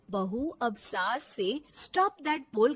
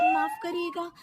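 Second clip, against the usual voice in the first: defiant retort; 5 dB louder than usual. Acted. Daughter-in-law talks back.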